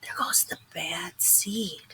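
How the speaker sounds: hushed unvoiced gossip; mumbled whisper. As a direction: mutter it like gossiping seeds. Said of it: "they mumble".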